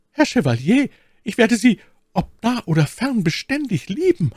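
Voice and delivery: high, fervent maid's voice; innige Ketty. Acted. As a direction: vow devotion in a high girlish voice. (innige, hohe Mädchenstimme)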